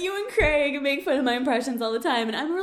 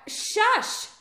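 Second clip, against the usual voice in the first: higher, sharp shush at mom; real shushing; 9 semitones higher than usual. Real, not acted. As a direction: shush an interrupting parent. Hushing mom interrupting the recording.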